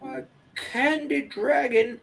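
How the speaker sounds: put-on high, slow puzzled old shopkeeper; puzzled old lady. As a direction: repeat it in puzzled surprise. [name] answers in character.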